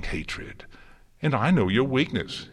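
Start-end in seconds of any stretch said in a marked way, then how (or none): 1.2-2.5 higher, louder taunt; gloating. A narrator's reading. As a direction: gloat over having found her weak spot. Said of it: "he gloated".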